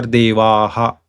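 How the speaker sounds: Devaha slow, with long final aa-ha; Devaha, lengthened echo vowel; prolonged visarga. Shown as a demonstration. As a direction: draw out the end of Devaha. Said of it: "if we prolong it little bit".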